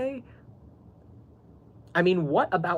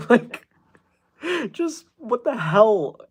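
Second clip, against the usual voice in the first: exasperated; 7 semitones higher, 4 dB louder, much slower than usual. Real, not acted. Exasperated at his messages.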